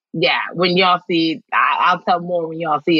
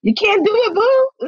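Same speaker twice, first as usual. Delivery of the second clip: much higher, teasing put-on voice; high-pitched mock quote. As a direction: lift it way up, like a mock brush-off. Acting out a mocking quote.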